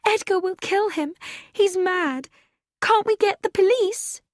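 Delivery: panicked cry for help, pitch way up; young woman fearing a shooting, frightened. A narrator's reading. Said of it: "Gina cried".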